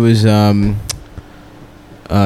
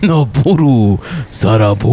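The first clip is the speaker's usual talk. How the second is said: fake Japanese gibberish; 3 semitones lower than usual.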